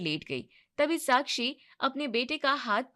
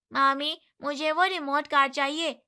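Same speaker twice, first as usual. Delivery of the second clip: put-on child voice; 4 semitones higher, 5 dB louder than usual. A narrator's reading. Child asking for toy.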